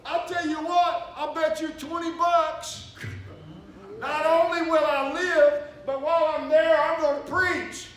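high, loud, slow cocky bragging. Retold or imitated. Preacher betting the laughing guard, loud cocky brag. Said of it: "in my redneckish arrogance".

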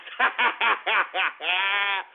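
mocking belly laugh; gloating laugh. Real, not acted. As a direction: laugh long at your own jab. Laughs at own jab.

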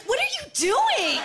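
high, loud squawk of alarm; mock outraged shock. Betsy to the guy trying something on her, shocked shriek. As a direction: shriek it in comic disbelief. Betsy reacts to boyfriend's attempt.

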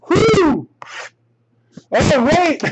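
high-pitched excited whoop; whoop, then cheer high in real delight; real excitement bursting out. Real, not acted. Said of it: "look at how excited I got".

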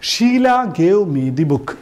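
very slow, word by word, reading the correct example; slow dictation. Shown as a demonstration. Teacher reads the correct sentence slowly to the class. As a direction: read the right sentence out slowly. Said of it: "ഇവിടെ gave എന്ന് പറയുന്നത്".

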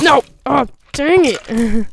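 loud, high, drawn-out outburst; frustrated yell. Real, not acted. Gamer who just missed his shot, frustrated yell. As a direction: cry out at a near miss.

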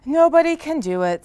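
high, loud complaint; loud declaration. Acted. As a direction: announce it to the whole herd. Elephant declares defeat.

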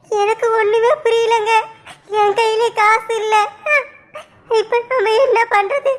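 crying through the words, breaking into sobs; a helpless, sobbing lament; tearful despair. Acted. Wife laments having no money or home to her husband, crying.

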